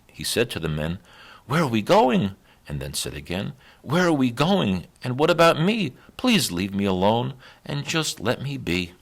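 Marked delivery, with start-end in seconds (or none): 1.5-2.4 frightened. A narrator's reading. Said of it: "The donkey was frightened". 3.8-8.9 frightened. A narrator's reading. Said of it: "The donkey was frightened".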